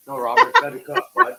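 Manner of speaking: loud telling-off to a dog; loud scolding. Real, not acted. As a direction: raise the voice at the dog.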